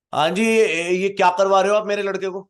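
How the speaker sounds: angry father. Retold or imitated. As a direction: confront him over what he's doing to your son.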